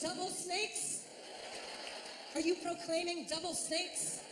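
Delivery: high, slow, incredulous question; hammed-up baffled query; mock bewilderment. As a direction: stretch it out in comic disbelief. Bewildered customers question the garbled call.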